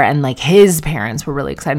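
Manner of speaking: ordinary delivery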